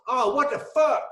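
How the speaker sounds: high, loud imitation of a buddy's drawn accent; King's yelled outburst. Retelling a buddy's outburst at Camp Rudder, mock yelling. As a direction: yell it in outrage, mimicking his accent. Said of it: "in his accent ... he never yelled".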